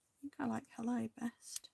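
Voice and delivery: whispered preference to herself. Real, not acted. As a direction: confide the choice in a whisper. Choosing a word privately.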